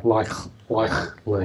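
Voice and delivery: LIKELY with the K from the back of the throat; Australian "likely" with a rear K; back-of-mouth K. Shown as a demonstration. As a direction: split "likely", K made far back. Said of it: "comes from the back of the mouth more".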